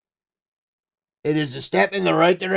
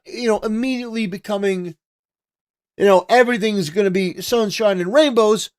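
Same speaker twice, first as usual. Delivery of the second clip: normal talking voice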